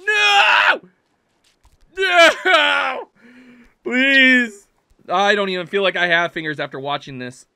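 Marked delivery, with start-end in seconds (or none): 0.1-4.7 high, loud cries of dismay; anguished shout. Real, not acted. Player watches progress lost, anguished shouts. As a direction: wail it out, begging. Climber falls in game.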